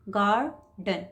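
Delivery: "Garden" slowly, split into गार and डन; unhurried syllable demo. Shown as a demonstration. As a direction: read "garden" at ease, pausing before डन. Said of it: "आराम से पढ़िए".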